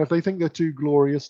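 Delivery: ordinary talk, nothing marked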